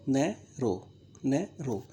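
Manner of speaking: "Narrow" broken in two, a pause between नै and रो; split into syllables. Shown as a demonstration. Teacher showing students the two syllables, "narrow" split at a pause. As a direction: say "narrow" as two separate beats, नै, then रो. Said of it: "एक बार तो आपने नै बोला और दूसरी बार आपने".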